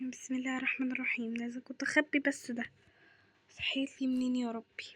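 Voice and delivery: low, hushed grumble; soft exasperated aside; muttering to oneself. Acted. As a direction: sink into a near-whisper, talking to yourself. Grumbling to herself after mother appears.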